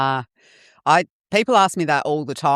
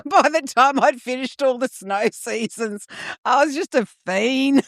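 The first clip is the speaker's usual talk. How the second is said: laughing; 10 semitones higher than usual. Real, not acted. Laughing while confessing drug past.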